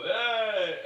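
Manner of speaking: a tipsy crowd shouting; drunken cheer. Acted. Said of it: "drunken people go".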